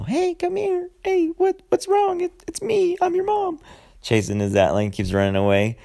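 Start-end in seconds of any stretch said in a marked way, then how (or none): 0.0-3.6 squeaky falsetto mom, much higher; mocking mother voice. Mocking the movie mom calling her son in a high falsetto. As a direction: coax the kid like a sing-song cartoon mom. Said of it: "But she's all".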